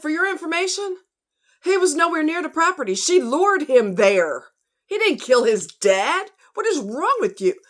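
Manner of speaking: high, loud, furious comeback; angry retort. Retold or imitated. Furious reply to YouTube commenters who blamed Scott. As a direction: tear into them. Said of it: "I said".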